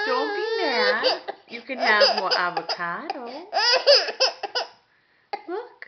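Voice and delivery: loud, teasing fake-cry to the baby; mock crying. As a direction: pretend to sob along with the baby. Soothing upset baby, mock crying.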